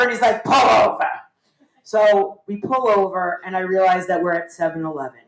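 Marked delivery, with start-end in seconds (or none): 0.4-1.1 raised voice, father ordering the driver; mock exasperated command, louder; retold stern order. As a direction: call out the order like a fed-up dad. Father orders nervous learner to stop.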